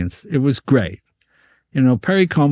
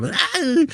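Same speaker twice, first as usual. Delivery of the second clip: mock scream. Guy stabbed in the leg.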